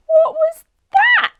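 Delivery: very high, loud, slow; alarmed cry; startled. A narrator's reading. Startled child.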